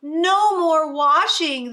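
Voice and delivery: loud happy chorus; gleeful shout. Acted. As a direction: cheer as the animals escape. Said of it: "the animals say".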